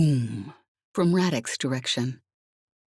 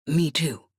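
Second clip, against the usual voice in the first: plain reading voice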